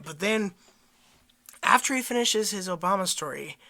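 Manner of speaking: usual speaking voice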